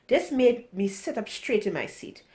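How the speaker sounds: plain reading voice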